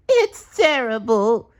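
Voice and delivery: high, slow, loud wail; crying SpongeBob. A narrator's reading. SpongeBob despairing to Patrick, high slow wail. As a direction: whine it out in despair. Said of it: "SpongeBob cried".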